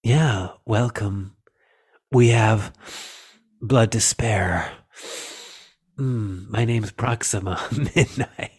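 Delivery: lightly voiced sexy alien villainess; sultry villain voice. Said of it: "the voice was like".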